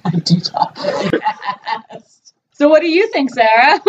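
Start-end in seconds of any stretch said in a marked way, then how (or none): 0.0-2.3 drawn-out, laughing admission; deliberately slow. Pretend. Teased fast talker replies to co-host, deliberately slow. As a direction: stretch it out slowly, amused.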